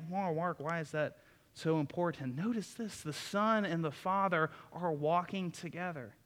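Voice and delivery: ordinary delivery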